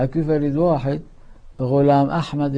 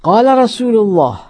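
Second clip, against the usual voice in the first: full-force preaching; 2 semitones higher, 7 dB louder than usual. Acted. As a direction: thunder the warning at the crowd.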